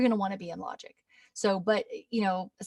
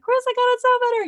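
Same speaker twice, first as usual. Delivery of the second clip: much higher, louder, fast squeal; excited fan; 9 dB louder, faster than usual. Retold or imitated. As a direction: gush like a thrilled student. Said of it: "Be like".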